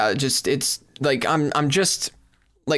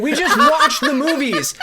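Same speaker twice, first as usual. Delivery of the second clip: higher, louder shout with laughter; laughing yell; 11 semitones higher, 5 dB louder, slower than usual. Real, not acted. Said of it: "which is why I'm yelling".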